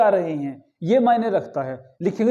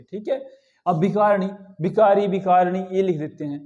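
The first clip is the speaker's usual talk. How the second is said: ordinary delivery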